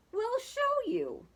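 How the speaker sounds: high, put-on eager cry; high-pitched volunteering; eager goat voice. A narrator's reading. Goats offer to lead.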